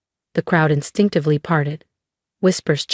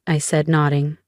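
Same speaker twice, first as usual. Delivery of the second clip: usual speaking voice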